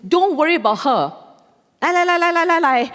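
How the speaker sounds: high, loud sing-song with a laugh; imitating the queue-jumper. Queue-jumper waving his group forward, mocking high sing-song. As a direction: mimic him brushing her off and calling his group. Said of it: "And then he said".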